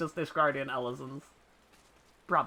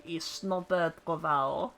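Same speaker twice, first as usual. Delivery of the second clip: slow put-on French name; French accent; slower than usual. Gamer trying out Snowbird's name, slow French accent. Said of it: "Snowbird's got a French accent".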